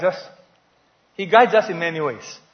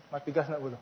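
ordinary delivery

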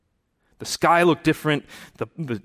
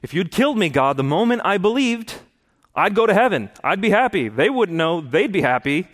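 mock-cheerful reasoning; 6 semitones higher than usual. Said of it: "'Cause I was thinking to myself".

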